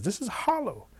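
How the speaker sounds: high-pitched storybook discovery; acted wonder, pitch leaping up; amazed rabbit. Rabbit voiced discovering hollow cane.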